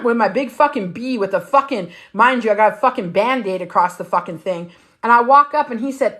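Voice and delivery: ordinary talk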